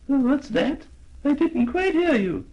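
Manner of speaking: put-on high old lady voice; a hard-of-hearing old lady. Old lady can't hear.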